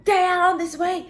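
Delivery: loud high yell of police coming; shouting the bluff. Retold or imitated. Woman shouting after the fleeing attacker, loud and high. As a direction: call out as if help is near. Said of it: "I was like".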